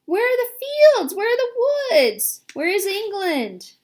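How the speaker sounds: wailing where are we; desperate cries. A narrator's reading. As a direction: insects lost at sea. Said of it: "they cried".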